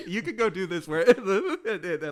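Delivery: retold protest broken by laughter; laughing mock complaint. Man telling his friend to stay out of his place, laughing. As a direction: giggle while voicing his grumble. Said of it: "but then he's like".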